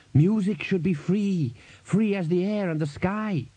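high, drawn-out ringing declaration; faint harmonics. A narrator's reading. Buddy answering what the roaring crowd is shouting, faintly harmonic voice. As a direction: proclaim it high and slowly, faintly musical. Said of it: "voice had a faint suggestion of harmonics".

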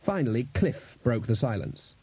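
ordinary delivery, nothing marked

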